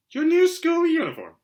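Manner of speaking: shrill aunt. Acted. Aunt Petunia answering Harry's question, high and tight. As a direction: bite out each word like a prim, cross aunt.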